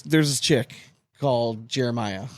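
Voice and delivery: usual speaking voice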